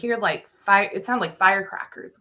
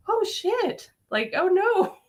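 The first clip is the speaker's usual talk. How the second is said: imitated dismay; 14 semitones higher, slower than usual. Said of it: "he's just like".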